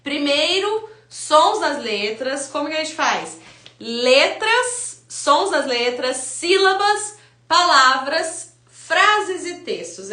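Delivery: each step said slowly and deliberately; slow listing. Shown as a demonstration. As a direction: lay out the order step by step, slowly. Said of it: "É essa ordem". (enumerando devagar, passo a passo)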